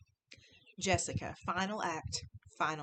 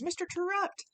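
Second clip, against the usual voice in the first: mild mock scream; 12 semitones higher than usual. A narrator's reading. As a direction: cry out the name like a restrained scream. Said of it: "I heard the screams".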